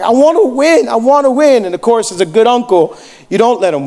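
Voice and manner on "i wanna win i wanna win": lightly voiced small boy, higher pitch; a retold child's plea, pitched up; imitated tearful nephew. Nine-year-old nephew losing to his uncle at video games, imitated pleading. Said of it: "nine years old. And, and he's like".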